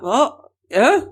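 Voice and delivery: high, mock-baffled questions; puzzled reaction. Local in Ireland hears travel called exotic, puzzled.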